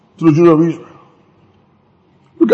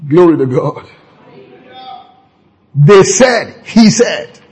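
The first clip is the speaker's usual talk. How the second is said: normal talking voice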